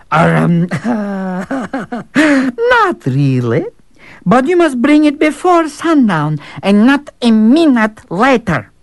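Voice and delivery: scheming servant. Acted. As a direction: savour the impossible task. Servant recovers.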